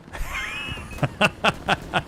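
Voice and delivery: high, breathless giggling fit; helpless laughter. Real, not acted. Commentator catching his own team mix-up, laughing. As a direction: crack up at your own blunder.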